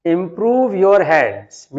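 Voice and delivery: pare your nails, stretched out word by word; very slow dictation. Shown as a demonstration. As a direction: spell it out slowly for the class to write. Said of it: "teacher reads sentence slowly". (बहुत धीरे-धीरे बोलकर लिखवाना)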